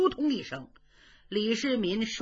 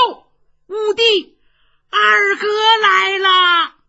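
slow, drawn-out shout much higher and louder; calling out; 11 semitones higher, 12 dB louder, slower than usual. Acted. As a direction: hail him as if from afar. Calling out a trick.

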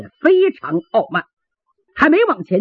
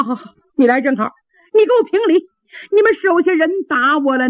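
high-pitched, indignant complaint; aggrieved protest; 7 semitones higher than usual. A narrator's reading. Slapped traveler complaining to gate officer, aggrieved and high-pitched. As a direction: pile up grievances, pitched high, threatening to leave.

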